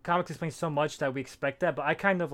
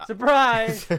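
loud, high mock surprise; 7 semitones higher, 8 dB louder than usual. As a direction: pop out a jokey surprise. Joking exclamation, echoing others.

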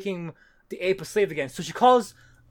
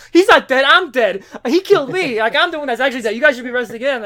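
much higher, far louder hammed-up ranting; raving mad; 6 semitones higher, 9 dB louder than usual. Retold villain ranting to police, hammed-up mad shouting. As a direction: rave like a cartoon madman. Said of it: "going raving mad".

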